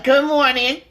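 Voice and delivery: loud, sour mock good morning; bitter greeting. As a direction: greet back with a nasty edge. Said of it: "your face is just looking all nasty".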